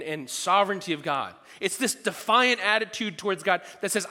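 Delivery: ordinary talk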